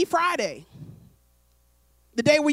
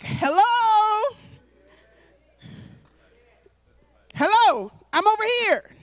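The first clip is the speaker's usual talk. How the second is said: higher, louder calling hello; God calling out; 6 semitones higher, 3 dB louder, slower than usual. Acted. God calling to people who listen to lies, pleading calls. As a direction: call out across the room to someone looking away. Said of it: "God is like".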